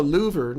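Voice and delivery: "louver" with an oo in place of short i; mispronounced liver. Acted. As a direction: say "louver", LOO-ver, for liver. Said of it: "What kind of accent would doctor say".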